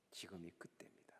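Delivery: hushed unvoiced whisper, low and soft. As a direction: lean in and whisper it. Preacher drops to whisper. (나지막한 속삭임)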